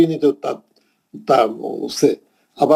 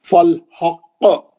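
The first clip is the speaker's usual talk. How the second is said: slow, heavy recitation with a strong qalqala; heavy tajweed reading, strong qalqala on हक; Quran recitation; 3 semitones higher, much slower than usual. Shown as a demonstration. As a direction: press the final qaf down hard and let it bounce. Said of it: "थोड़ा strongly पढ़ा".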